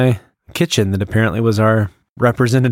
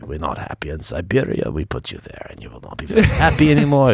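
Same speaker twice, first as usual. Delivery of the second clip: put-on Russian accent; 5 semitones higher than usual. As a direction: threaten exile as a comic Russian, sinking then lifting. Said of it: "Russian [name]".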